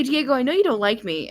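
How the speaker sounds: normal talking voice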